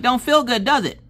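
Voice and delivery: high, loud taunting scold; scolding mama. Retold or imitated. Mother scolds boy who flipped off daughter, high and pointed. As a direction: turn it back on him, pointed.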